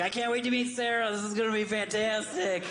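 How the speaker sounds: high, giddy anticipation; mock excitement. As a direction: gush like a kid waiting for a treat.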